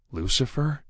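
Lucifer asked high with a sharp rise; shocked question. Acted. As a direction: Lucifer in disbelief, rising. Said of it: "anger making way for shock".

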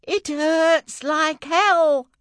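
high, loud, very slow wail; girl wailing high and slow in pain; agonised. Acted. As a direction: stretch out every word in agony. Girl in labour.

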